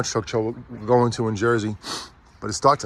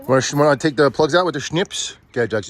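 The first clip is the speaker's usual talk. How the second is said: ordinary talk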